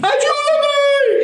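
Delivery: shrieked high mock scream for help; panicked cry for help. Acting out someone in danger, shrieking for help.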